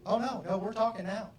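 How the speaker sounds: acted combative insistence; insistent. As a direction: refuse to let them stay silent. High D insisting in acted quarrel.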